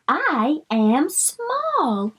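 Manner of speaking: drawn out, high small-bug line; slow sounded-out reading. Shown as a demonstration. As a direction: read it slowly like a small bug. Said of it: "bug reading line".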